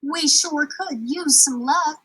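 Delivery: longing for a change of luck; girl dreaming of luck, drawn out; hopeful girl. Acted. Juanetta hopeful.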